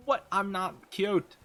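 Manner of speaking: slow high flustered protest; flustered denial. Real, not acted.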